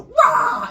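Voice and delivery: high, loud warrior yell; mock attack holler; battle cry. Young god showing girlfriend his plan, mock war cry.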